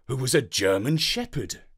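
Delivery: ordinary delivery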